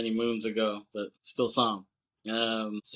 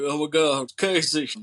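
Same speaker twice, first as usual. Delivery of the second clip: higher, louder cheesy Southern accent imitation; mock Southern accent; 4 semitones higher, 4 dB louder than usual. Podcaster imitating the husband greeting his unborn baby, mock Southern accent. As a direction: ham up the hero's Southern accent. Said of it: "he has this cheesy-ass accent".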